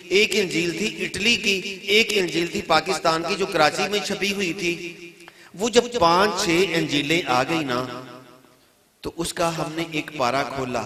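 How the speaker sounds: high, emphatic sermon storytelling; ringing, high-pitched preacher's delivery. Real, not acted. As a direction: drive each point home to the congregation. Preaches a retold debate forcefully.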